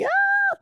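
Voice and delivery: high shrieking squeal back; excited squeal. Retold or imitated. Arriving at the birthday, squealing back at friends. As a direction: squeal right back at them. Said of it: "I'm like".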